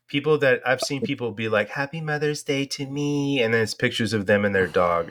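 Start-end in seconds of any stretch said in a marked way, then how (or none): 1.7-3.4 mocking sing-song. Mocking dog owners' post.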